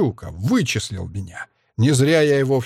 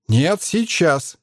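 slow, higher-pitched, insistent demand; 6 semitones higher, much slower than usual. A narrator's reading. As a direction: insist firmly, drawing out each word. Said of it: "потребовала Асара". (требовательно, с нажимом)

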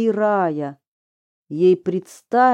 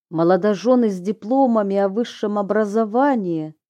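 ordinary delivery

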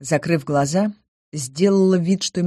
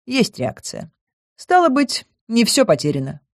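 smug; 6 semitones higher than usual. A narrator's reading. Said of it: "самодовольно изрёк".

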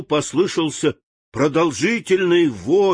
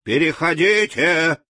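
shout; 5 semitones higher than usual. A narrator's reading. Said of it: "закричал Страшила".

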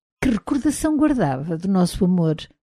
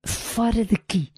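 slow, hushed hiss; soft but menacing dismissal; 3 semitones higher, 6 dB quieter than usual. A narrator's reading. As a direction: hiss the order under your breath. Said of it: "sibilei-lhe".

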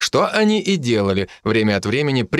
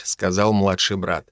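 ordinary delivery, nothing marked

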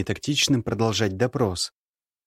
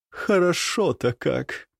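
high, slow pleased sigh; contented woman; 6 semitones higher, slower than usual. Acted. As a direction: savour it like a lady. Nastasya relaxing, voiced. (довольный женский голос)

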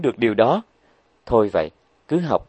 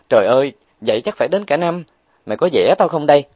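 exasperated outburst; 5 semitones higher, 4 dB louder, faster than usual. Acted. Huệ Linh protests a year's study.

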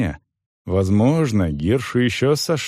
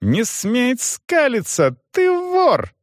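slow, very high-pitched outrage; furious yelling; 14 semitones higher, slower than usual. A narrator's reading. Young man accuses grinning manager, furious. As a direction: stretch each accusing word in fury. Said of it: "заорал тот".

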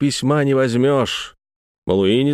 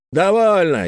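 sharp; 7 semitones higher than usual. A narrator's reading. Antifer cuts him off.

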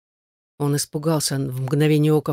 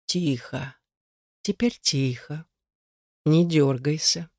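slow, soft hushing; soft, insinuating voice; 3 dB quieter, slower than usual. A narrator's reading. Stranger behind Igar hushes him, slow insinuating voice. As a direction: soothe him slowly and softly. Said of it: "Мягкий и вкрадчивый".

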